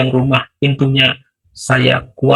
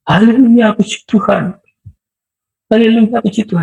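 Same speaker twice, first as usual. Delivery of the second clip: high, loud hallelujah shout; preacher shouts hallelujah in joy; jubilant praise; 11 semitones higher, 3 dB louder than usual. Real, not acted. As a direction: burst out in praise to God.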